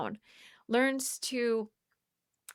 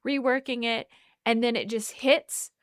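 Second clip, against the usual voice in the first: ordinary talk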